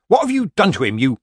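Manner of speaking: loud, high, angry demand; glaring accusation. A narrator's reading. Grandmother accusing Granny over her sobbing grandchild, angry. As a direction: round on her, voice leaping up. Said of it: "glared into Granny's face".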